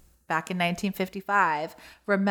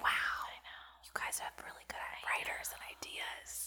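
breathy awed whisper; 14 dB quieter than usual. Acted. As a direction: hush it in awe.

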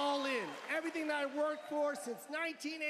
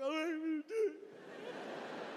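shaky laughing resolve; 5 semitones higher, slower than usual. Retold or imitated. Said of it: "I was like".

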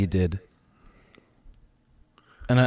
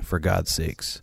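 ordinary talk

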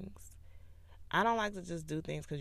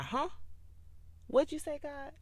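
mock clueless; 10 semitones higher than usual. Playing herself not hearing God.

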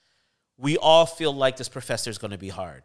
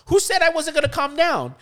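much higher, louder mock indignation; indignant outburst; 13 semitones higher, 4 dB louder than usual. Student twisting the professor's words, high loud indignant mock retort. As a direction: fire it back, playing dumb.

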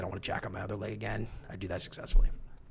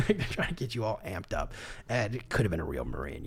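usual speaking voice

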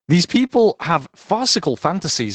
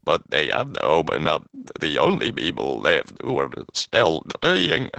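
put-on breathy whispered Scottish accent; a hushed Scottish robot-ish reading; Scottish breathing voice; 10 semitones lower, 3 dB quieter than usual. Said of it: "half tempted to do the, breathing in voice, but in a Scottish accent".

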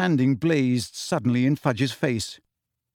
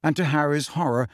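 usual speaking voice

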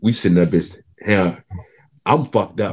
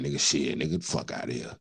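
inner grumble; 6 semitones lower, 10 dB quieter than usual. Retold or imitated. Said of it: "In my mind, I'm like".

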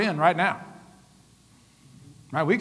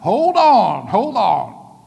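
higher, louder hold on, hold on, pulling the room back; calling a halt; 3 semitones higher, 3 dB louder than usual. Retold or imitated. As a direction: stop the service: hold on, it's drifting off. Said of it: "I'm like".

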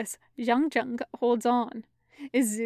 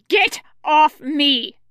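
loud, slow, raised scream; 4 semitones higher, 8 dB louder, slower than usual. A narrator's reading. Pinned fighter strikes at the beast, screaming. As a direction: scream it with all your force. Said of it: "he screams".

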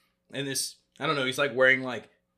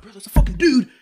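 high, loud frantic warning; 13 semitones higher, 7 dB louder than usual. Retold or imitated. Son warning stepdad about stranger, high and loud. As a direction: sound the alarm, pitched way up. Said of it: "I'm like".

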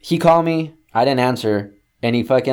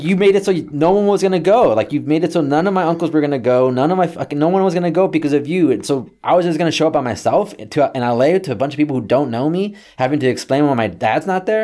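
high, insistent retold rant; acted heated justification; aggrieved arguing; 6 semitones higher, 3 dB louder than usual. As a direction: pile up grievances in a raised voice. Acted phone quarrel with dad.

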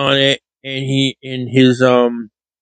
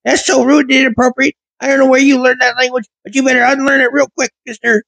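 scolding teacher; 11 semitones higher than usual. Retold or imitated. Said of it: "she stood there yelling at me".

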